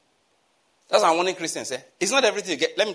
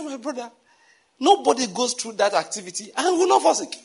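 high-pitched, incredulous reply; amused retort; 8 semitones higher than usual. Retold or imitated. Telling his brother sickness was bound to follow, amused. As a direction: reason with him, pitch raised in amusement. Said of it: "I was laughing".

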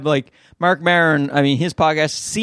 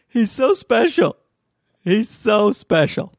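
cooing; 5 semitones higher, slower than usual. Real, not acted. Cooing over his baby.

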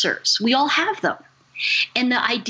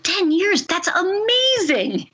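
high, marveling exclamation, drawn out at the end; amazed delight; 11 semitones higher, slower than usual. Retold or imitated. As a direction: react as if it's wonderful news, pitch way up. Retold amazed reply to caller.

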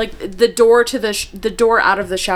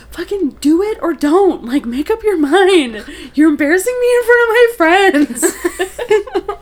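annoyed mom; 8 semitones higher than usual. Retold or imitated. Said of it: "she was just like".

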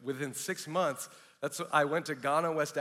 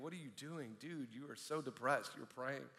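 very soft, under the breath; self-reproaching thought; 2 semitones lower, 13 dB quieter than usual. Retold or imitated. DJ to himself after praying, quiet disbelief. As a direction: quietly chide yourself. Snapping out of prayer, scolding himself.

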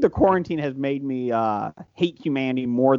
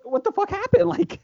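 incredulous outburst. Retold or imitated. Disbelief at the priest list.

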